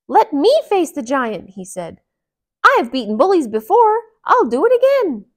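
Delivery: loud, high heroic boast; Mickey volunteering, loud and proud; bold woodcutter. Acted. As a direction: declare it like a fearless hero.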